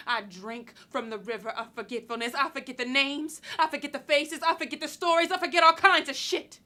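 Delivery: building outburst. Acted. Woman telling a man what she forgets, building to a loud outburst.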